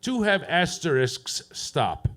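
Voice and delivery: stilted dictation with a falling, separate "stop"; telegram read-out. Acted. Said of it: "They sent me a telegram".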